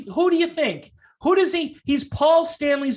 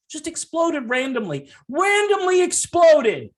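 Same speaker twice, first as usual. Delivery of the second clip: mock disbelief; 6 semitones higher, 4 dB louder than usual. Mocking the official explanation.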